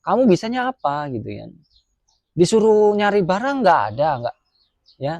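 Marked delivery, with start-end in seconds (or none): 0.0-1.1 raised, pitched-up mock scolding; retold scolding. Japanese boss scolding the worker, mock angry. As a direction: snap the question back like an angry boss. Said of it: "dibentak-bentak".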